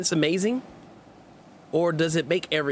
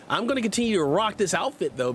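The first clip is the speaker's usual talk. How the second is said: normal talking voice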